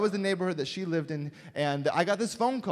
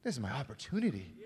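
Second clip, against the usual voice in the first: hushed resolve; 5 semitones lower, 8 dB quieter, faster than usual. Retold or imitated.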